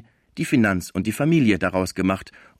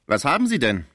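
mocking; 5 semitones higher, 4 dB louder than usual. A narrator's reading. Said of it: "fragte er spöttisch".

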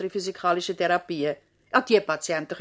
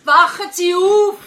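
loud, high, slow call; 10 semitones higher, 11 dB louder, slower than usual. Acted. Nurse trying to wake the dozing patient, a loud slow call. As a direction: rouse him from his sleep.

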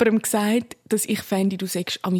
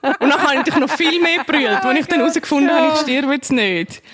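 loud relieved laughter through the words; laughing with relief; 7 semitones higher, 5 dB louder than usual. Real, not acted. Laughing with relief to friends after the heart check.

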